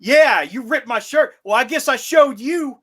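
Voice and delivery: mock the bluster; mocking tough guy. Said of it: "the other guy's the only one who got any action".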